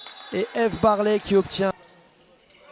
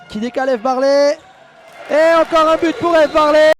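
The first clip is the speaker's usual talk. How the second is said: rising, louder goal call; excited sports commentary; 7 semitones higher, 7 dB louder than usual. Real, not acted. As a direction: build into the goal shout.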